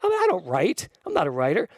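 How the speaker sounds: high-pitched, incredulous protest, acted out; imitating a baffled refusal; stunned disbelief. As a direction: play someone floored by an absurd idea, starting very high and settling. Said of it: "so profound she had to pull off".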